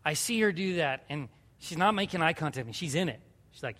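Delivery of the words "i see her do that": usual speaking voice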